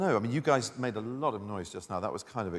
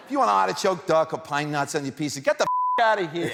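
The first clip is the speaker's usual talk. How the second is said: brash Brooklyn yell; 7 semitones higher, 7 dB louder than usual. Shown as a demonstration. Brooklyn reader blows up at toppings.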